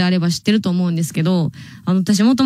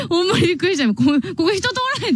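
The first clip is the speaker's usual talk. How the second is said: higher, quick, still startled; real surprise; 8 semitones higher, faster than usual. Real, not acted. To listeners after a stranger walked past, startled and higher. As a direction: blurt it out, still shaken by the surprise.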